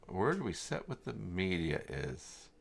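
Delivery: normal talking voice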